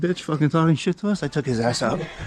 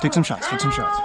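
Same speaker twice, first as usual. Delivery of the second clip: urgent call. Real, not acted. Urging teammate to fire.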